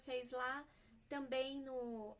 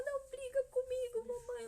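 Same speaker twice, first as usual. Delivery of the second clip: put-on high pleading child voice, much higher and louder; little boy's voice; 11 semitones higher, 5 dB louder than usual. Son Samuel begging his mother not to scold, high child voice. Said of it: "ele fala".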